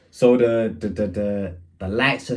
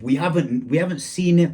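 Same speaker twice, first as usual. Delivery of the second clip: ordinary talk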